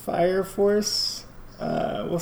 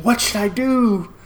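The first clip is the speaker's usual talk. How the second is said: hammed-up anguished wondering, higher and louder; melodramatic; 6 semitones higher, 5 dB louder than usual. Host mimics a drenched-in-melodramatics hero for listeners, hammed-up. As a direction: play the lost hero, mock-melodramatic. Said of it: "melodramatics and just wondering".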